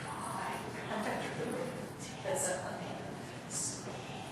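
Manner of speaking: very soft unvoiced whisper, slow and spaced. Shown as a demonstration. Teacher showing the right way to whisper the song. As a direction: whisper each word gently. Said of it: "You have to go".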